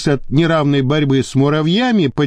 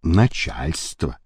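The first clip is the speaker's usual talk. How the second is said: lower, slow and smug, savouring it; smug grin; 10 semitones lower, 4 dB quieter, slower than usual. A narrator's reading. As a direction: hand over the phone with a gloating grin. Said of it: "с довольной ухмылкой".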